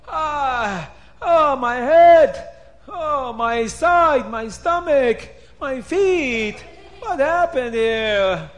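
hammed-up high, loud moaning in agony; pained wailing. A narrator's reading. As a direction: wail over every aching part, drawn out. Paroi wakes up battered.

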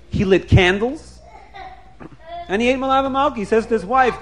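usual speaking voice

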